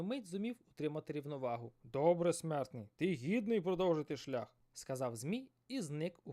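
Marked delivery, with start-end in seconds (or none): 1.8-4.5 loud booming sea serpent; thunderous serpent voice. Acted. Giant serpent challenging the fisherman, loud and booming. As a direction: boom it like thunder. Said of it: "голосом, що нагадував грім".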